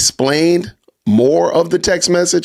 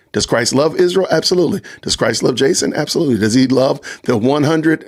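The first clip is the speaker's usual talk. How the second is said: ordinary delivery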